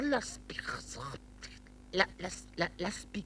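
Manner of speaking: whispered puzzled attempt. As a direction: mouth the hard word under your breath. Puzzled over the word.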